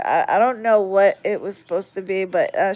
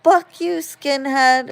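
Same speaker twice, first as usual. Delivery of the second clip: put-on high, louder little girl's taunt; little sister's taunt; 6 semitones higher, 3 dB louder, slower than usual. Imitating the younger sister copying the curse, higher. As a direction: chirp it like a copycat little sister. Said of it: "Juliana saying the same thing".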